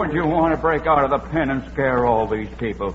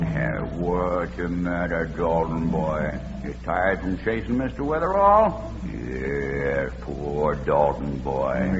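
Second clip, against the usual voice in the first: slow, lower and softer, tender; soothing; 7 semitones lower, 4 dB quieter, slower than usual. Acted. To his tired prize bull, slow tender soothing. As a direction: coo to the tired animal.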